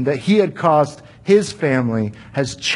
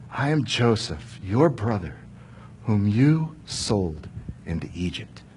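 tender reveal; 6 semitones lower, 7 dB quieter than usual. Acted. Joseph reveals himself to brothers.

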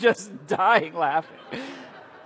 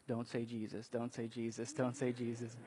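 much softer, lower pleading mutter; hushed dread; 6 semitones lower, 12 dB quieter than usual. Pretend. Saul dreading the answer, softly pleading. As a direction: beg under your breath.

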